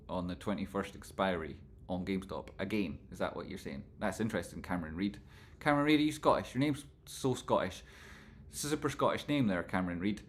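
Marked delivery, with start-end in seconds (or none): none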